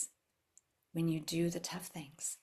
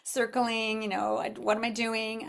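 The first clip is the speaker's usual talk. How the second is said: higher, fast, complaining self-talk; mock whiny doubt; 6 semitones higher, 3 dB louder than usual. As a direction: whine about skipping the work. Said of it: "And I'm like".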